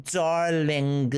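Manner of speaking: put-on drawn-out French-accented darling. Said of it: "with your lovely French accent".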